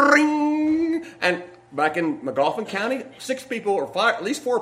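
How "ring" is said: put-on high trilled "brrring" of an old phone; imitated phone ring. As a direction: roll it high and held like an old telephone bell. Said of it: "had that loud".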